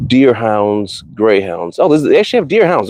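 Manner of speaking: normal talking voice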